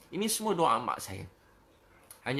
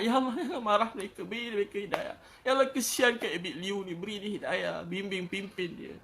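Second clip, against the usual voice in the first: high pleading voice, softening towards the end; tearful prayer, imitated; 5 semitones higher than usual. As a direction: retell a tender, tearful plea, slowing as it closes. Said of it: "Tengah malam nangis untuk umat". (meniru doa sayu, merayu dengan suara tinggi)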